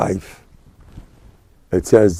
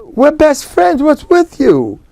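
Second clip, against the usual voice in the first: much higher, pleading disbelief; pleading friend; 11 semitones higher than usual. Retold or imitated. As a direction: plead with your old drinking buddy. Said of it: "And the guy says".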